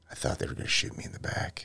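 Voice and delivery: hushed, nearly whispered dramatic line; dramatic book line. Acted. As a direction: drop your voice for the book's big moment. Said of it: "And then in the book, we say".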